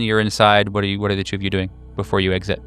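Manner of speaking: ordinary talk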